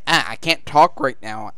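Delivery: ordinary talk, nothing marked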